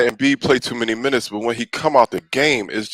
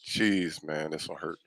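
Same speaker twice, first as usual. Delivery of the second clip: softer, lower and sinking toward silence; more and more defeated mumble over the loss; dejected, fading; 6 semitones lower, 11 dB quieter than usual. Real, not acted. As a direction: let the pain drain your voice. Mourning the loss.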